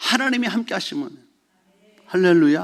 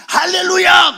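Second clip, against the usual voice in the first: loud and higher; calling out to a weeping congregation; hallelujah shout; 11 semitones higher, 9 dB louder, slower than usual. Retold or imitated. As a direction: fling it out again. Preacher calling out again.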